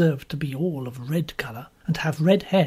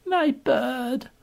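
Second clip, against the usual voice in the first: higher, slow coo of a fond woman; lightly voiced fussing nurse; 9 semitones higher than usual. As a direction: gently coo over the girl. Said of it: "calling her".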